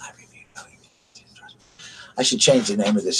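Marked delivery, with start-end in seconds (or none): 0.0-1.5 barely audible whisper. Shown as a demonstration. Streamer repeats the review claim for viewers, whispering. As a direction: whisper it very softly. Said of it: "We'll just whisper like this to you".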